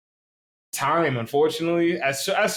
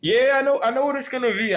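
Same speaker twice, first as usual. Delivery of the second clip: excited; 12 semitones higher, 4 dB louder than usual. Real, not acted. Teasing about a secret car.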